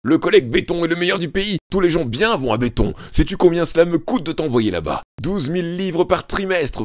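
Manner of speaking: loud, high ranting father; furious tirade. A narrator's reading. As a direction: rage at the son without pause.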